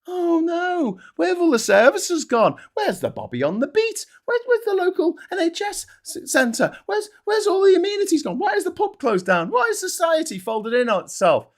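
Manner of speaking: mock whining villager. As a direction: parody the moaning villager, pitch up. Mocking villagers complaining.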